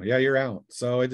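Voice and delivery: ordinary talk, nothing marked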